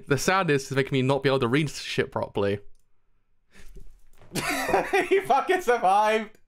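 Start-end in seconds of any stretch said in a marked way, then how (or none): 4.3-5.0 high delighted laugh. Real, not acted. Streamer seeing the hero is alive, elated laugh. Overjoyed character survived. 5.1-6.4 high, drawn-out laughing exclamation; elated cry. Real, not acted. Streamer learning the hero survived, overjoyed.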